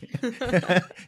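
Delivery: real, high-pitched burst of laughter; a bright, high laugh. Real, not acted. Host laughing at the guest's joke, high laugh.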